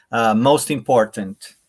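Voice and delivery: ordinary talk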